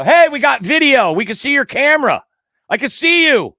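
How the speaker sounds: loud and high, suddenly delighted; excited. Real, not acted. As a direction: call out to him, thrilled. Video suddenly comes through.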